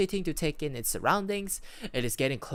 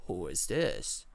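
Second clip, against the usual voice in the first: raspy voice; slower than usual. Acted. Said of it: "A raspy voice comes across the walkie-talkie".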